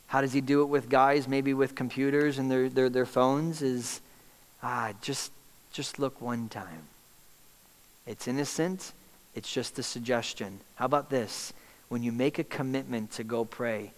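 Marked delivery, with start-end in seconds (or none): 3.6-10.6 softer, coaxing little nudge; soft tempting voice. Acted. Satan whispering temptation to a man with his phone, softer and coaxing. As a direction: slip the thought in quietly, like bait.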